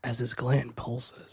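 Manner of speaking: slow, soft seductive purr; sultry narrator. Pretend. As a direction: say it like a come-on. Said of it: "in your most seductive voice".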